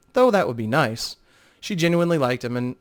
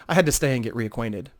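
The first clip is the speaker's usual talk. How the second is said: ordinary talk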